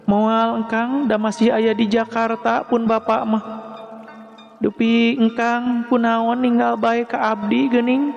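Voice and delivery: high-pitched polite female voice; reply and ask back in a high feminine lilt; Neng Jejeh. A narrator's reading. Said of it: "Ceuk Neng Jejeh malik nanya". (sora istri luhur, malik nanya)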